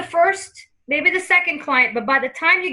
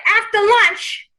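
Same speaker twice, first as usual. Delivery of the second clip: drawn-out, raised New York grandma; New York accent; 6 semitones higher, 4 dB louder, much slower than usual. Acted. Medium voicing the client's grandmother, New York accent. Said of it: "she's saying it with the New York accent".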